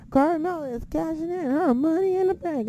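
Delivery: high-pitched announcement voice; imitated ring announcer. Announcing the cash-in to the crowd, mock ring announcer. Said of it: "we hear the announcement".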